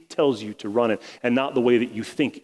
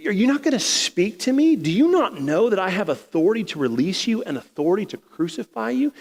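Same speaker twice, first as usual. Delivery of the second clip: much higher, louder indignant Pilate voice; worked-up Pilate; 9 semitones higher, 3 dB louder than usual. Acted. Pilate confronts silent Jesus about his authority, worked up and much higher. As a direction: let Pilate boil over at the silence. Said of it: "Pilate starts getting a little worked up".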